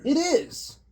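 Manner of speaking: surprised. Real, not acted. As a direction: burst out as you spot him. Spots the target.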